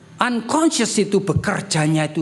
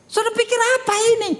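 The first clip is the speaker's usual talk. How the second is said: rhetorical challenge; 14 semitones higher, much faster than usual. Acted. Preacher challenging congregation, high.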